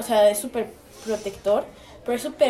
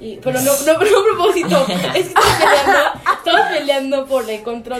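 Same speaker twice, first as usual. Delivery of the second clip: normal talking voice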